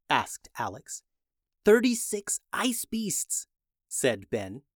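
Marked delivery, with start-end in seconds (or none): 1.6-3.5 slow breathy whisper, no voice at all. Acted. Ben whispering to his brother so the ice beasts won't hear, slow unvoiced whisper. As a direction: hush it so the beasts can't hear. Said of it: "Ben whispered to Alex".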